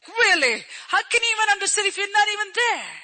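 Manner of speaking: high, challenging "Really?"; incredulous retort to false sympathy; acted disbelief. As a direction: voice an indignant inner objection. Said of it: "we think like".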